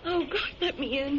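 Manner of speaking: sobbing plea; crying. Acted. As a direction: cry as you beg. Wounded woman breaks down.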